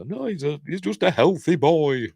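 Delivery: airy dismissal in a high put-on accent; Kathy put-on accent. Imitating Kathy insisting the boy is fine, high mock accent. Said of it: "I'm doing that accent again".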